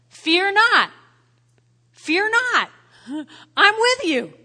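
loud, high proclamation of scripture; proclaiming loudly. Acted. Preacher quoting the Bible to the congregation, loud proclamation. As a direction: ring out God's promise.

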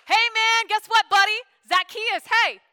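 put-on loud, high, beaming call to an old friend; joyful greeting. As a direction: shout his name like a buddy you're thrilled to see. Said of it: "That is a joyful".

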